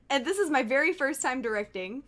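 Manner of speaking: ordinary delivery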